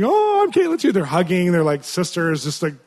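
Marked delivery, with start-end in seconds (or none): 0.0-0.9 very high, loud delighted shriek; excited scream. Retold or imitated. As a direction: shriek it with joy at the door. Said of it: "our Caitlin's screaming".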